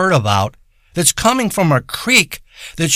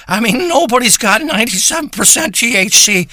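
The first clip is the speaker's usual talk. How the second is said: higher, hyped-up push on nobody's; excited disbelief; 7 semitones higher than usual. Acted. Stoner gushing to listeners about his ninety-seven percent weed, excited disbelief. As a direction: sell it like it's a miracle.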